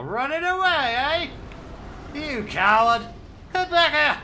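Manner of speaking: high, loud jeering shout; taunting a fleeing foe, shouting; mock angry yell. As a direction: yell after the coward who runs off. Yelling at fleeing enemy.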